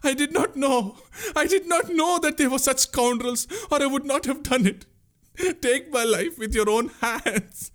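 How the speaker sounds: very high, sobbing wail; anguished shriek. A narrator's reading. Boatman pleading ignorance to the prince, shrieking in anguish. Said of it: "The boatman shrieked in anguish".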